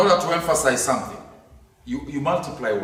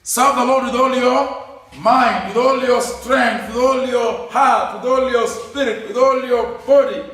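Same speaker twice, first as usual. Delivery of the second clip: preaching scripture; 8 semitones higher, 5 dB louder than usual. Acted. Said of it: "scripture talks like that".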